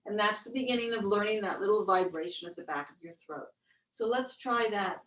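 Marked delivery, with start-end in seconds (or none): none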